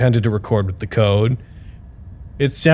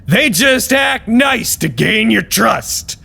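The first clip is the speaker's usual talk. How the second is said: high, slow, conspiratorial voice; pitched-up mock paranoia; paranoid scientist; 12 semitones higher, slower than usual. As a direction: play the tall one, darkly suspicious. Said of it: "the tall one said".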